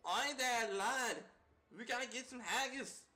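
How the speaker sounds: a rough attempt at a Scottish accent; playful mock Scottish greeting. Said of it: "She's Scottish".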